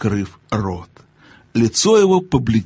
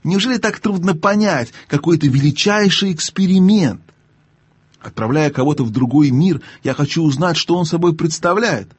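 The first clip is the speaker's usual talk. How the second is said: higher, exasperated uncle; raised-pitch exasperation; impatient; 6 semitones higher than usual. A narrator's reading. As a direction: explain with rising impatience. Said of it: "в нетерпении повысил голос".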